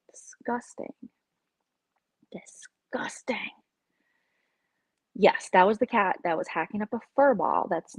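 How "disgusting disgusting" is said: softer, whispered disgust. Real, not acted. About the cat owners, a soft whispered disgust. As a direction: breathe it out in revulsion.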